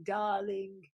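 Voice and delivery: usual speaking voice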